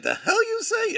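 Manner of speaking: high, loud burst of disbelief; sudden high-pitched outburst; mock incredulous shout. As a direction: exclaim it in hammed-up astonishment. Acting out a viewer's incredulous comment.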